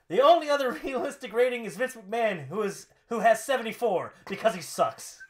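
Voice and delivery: mock-outraged dig at the boss; Rusev. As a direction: declare it in a loud huff. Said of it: "Rusev, what do you think about".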